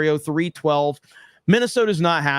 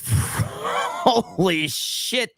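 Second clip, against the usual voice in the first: laughing; 2 semitones higher, much slower than usual. Real, not acted. Laughing at the mayor.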